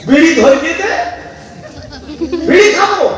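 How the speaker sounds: high, laughing mock demand; mocking lazy husband. Preacher mocking a husband who wants a bidi at dawn, high and laughing.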